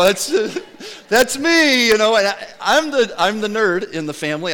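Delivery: normal talking voice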